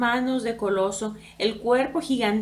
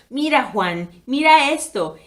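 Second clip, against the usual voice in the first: raised, narrated shout of triumph without real laughter; gritando; 3 semitones higher, 7 dB louder than usual. A narrator's reading. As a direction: call it out loudly to his brother. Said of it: "Reía gritando". (gritando con júbilo)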